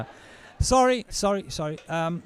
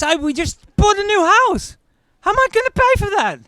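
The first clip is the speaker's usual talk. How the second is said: much higher, louder, fast outraged rant; furious ex; 8 dB louder, faster than usual. Acted. Furious ex panicking over the new house, high shrill rant. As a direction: shriek like the money's gone. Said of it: "she's furious".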